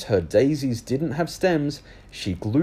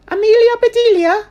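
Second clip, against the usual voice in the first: put-on very high, louder little girl; high girl calling; 11 dB louder than usual. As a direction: call out to a friend. Rose calls Amelia.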